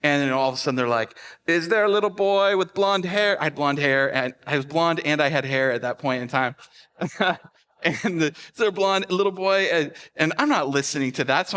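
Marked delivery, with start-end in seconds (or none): 1.5-3.4 high, loud mock store-wide callout; calling out. Store staff searching for a lost boy, calling out. 8.3-9.6 high, raised mock store callout; calling out. Store staff searching for a blonde boy, calling out.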